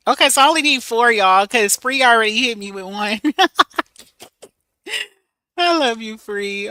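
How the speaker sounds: loud, high, fast and giggly; excited laughing. Real, not acted. Thanking Free for the gift, loud and laughing. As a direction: rush it out with a happy laugh.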